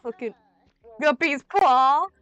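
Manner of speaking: hammed-up eagerness, swelling loud and high; mocking own earlier self. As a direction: imitate your foolish self, stretching the last words. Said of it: "I'm like".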